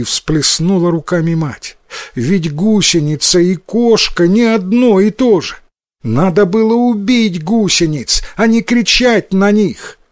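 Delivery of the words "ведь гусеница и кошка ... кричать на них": lightly voiced scolding mother, higher pitch; exasperated mother. A narrator's reading. Mother explains caterpillars are not cats, mildly scolding. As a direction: lecture a silly child in a raised pitch. Mother corrects her foolish son.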